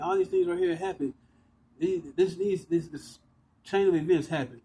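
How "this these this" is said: normal talking voice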